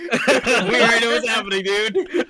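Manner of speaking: high, laughing tease; cracking up while teasing. Real, not acted. Laughing at predictable steal.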